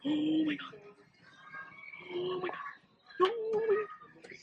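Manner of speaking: gushing squeal. Real, not acted. Shopper melting over a cute find, high gushing squeal.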